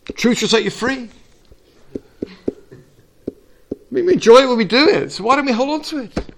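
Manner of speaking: preacher lifting the congregation; preaching. Acted. Preacher exhorting the church, fired up.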